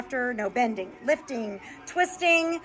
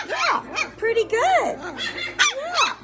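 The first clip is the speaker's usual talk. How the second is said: very high, cooing praise; puppy coo; 14 semitones higher, much slower than usual. Real, not acted. Cooing at the puppies.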